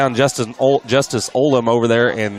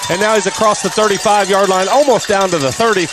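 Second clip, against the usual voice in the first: climbing higher and louder as he breaks free; excited return call; 11 semitones higher, 5 dB louder than usual. Real, not acted. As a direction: ride the run all the way down the field. Big kick return called live.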